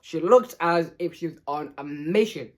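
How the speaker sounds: usual speaking voice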